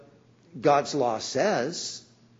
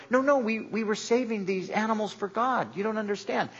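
higher-pitched imitation of protesting self-justification; making excuses; 7 semitones higher than usual. Saul excusing himself when judgment is pronounced, higher-pitched protest. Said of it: "He would always come up with an excuse".

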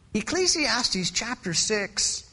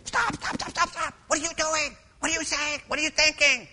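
exasperated scolding; 3 semitones higher, 4 dB quieter than usual. Acted. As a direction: shake sense into someone without raising the volume. Imagined shaking sense into Solomon.

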